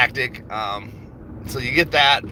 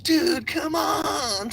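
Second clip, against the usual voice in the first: much higher, whiny mocking dude voice; mock Roaring Kitty; 3 dB quieter, much slower than usual. Mocking how the streamer talks live, high whine. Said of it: "talks like that".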